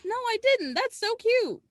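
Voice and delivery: very high, delighted coo; real gushing in a high voice. Real, not acted. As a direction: squeal over something adorable. Gushing at a cute detail.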